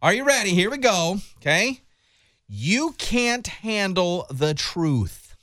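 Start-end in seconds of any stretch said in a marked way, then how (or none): 2.5-5.3 "You can't handle the truth" stretched very slowly, word by word; "You can't handle the truth" over-enunciated, very slow; exaggerated mouthing. Shown as a demonstration. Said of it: "what you're doing with your lips".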